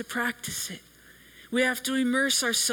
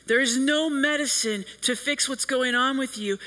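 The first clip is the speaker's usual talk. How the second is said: normal talking voice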